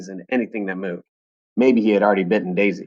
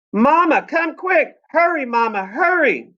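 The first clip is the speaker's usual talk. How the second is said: high, louder panicked cry for Mama; girl yelling for help; 5 dB louder, slower than usual. Acted. Daisy calling her mother from the hillside, high frightened yell. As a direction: shout it like a scared child. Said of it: "I heard Daisy yell".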